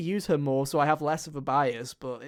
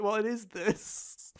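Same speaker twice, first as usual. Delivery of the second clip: very slow, higher, drawn-out baffled question; baffled what; 5 semitones higher, 5 dB quieter, much slower than usual. Retold or imitated. Player confused by a new character, slow baffled drawl. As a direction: stretch it out, puzzled and lost. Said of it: "and I'm like".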